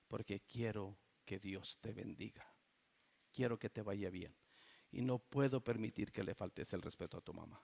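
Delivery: soft, tender words of a father disciplining; gentle fatherly correction. Retold or imitated. Pastor quietly correcting his son after the meeting, soft and tender. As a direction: say it softly, hurting with him. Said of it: "Y nada más les decía yo".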